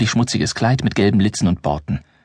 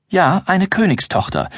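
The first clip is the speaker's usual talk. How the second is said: put-on higher, slow, insistent girl; unfazed girl; 8 semitones higher than usual. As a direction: insist calmly on being a princess. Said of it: "fährt das Schneewittchen unbeirrt fort".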